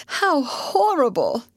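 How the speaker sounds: high, drawn-out cry of horror; horrified exclamation. A narrator's reading. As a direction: wail it slowly, aghast. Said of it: "screamed Miss Pennington".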